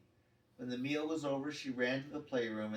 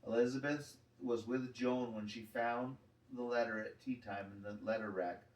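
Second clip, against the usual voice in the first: plain reading voice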